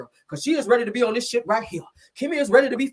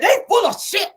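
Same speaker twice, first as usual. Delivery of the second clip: high-pitched, louder mock yell; retold yelling; 11 semitones higher, 5 dB louder than usual. Nell yelling indoors after the fight, imitated high yell. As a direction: yell it out as an imitation of an angry friend. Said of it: "She's still yelling".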